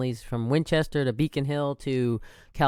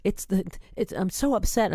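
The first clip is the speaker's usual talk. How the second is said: higher, whiny take on a distressed friend; mimicking upset friend; 6 semitones higher than usual. Retelling how the upset friend sounded on the phone, mock distressed. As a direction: play the friend fretting over the election. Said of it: "She's like".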